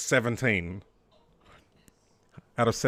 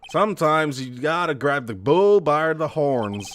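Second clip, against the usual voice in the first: put-on American accent; 2 semitones higher than usual. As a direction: play a cowboy partner with an American accent. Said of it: "how's my American accent".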